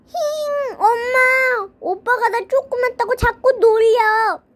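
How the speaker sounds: high, tearful tattling voice; whiny little sister. Acted. Sister telling mom her brother teases her, whiny. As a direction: whimper and whine in a small child's high voice.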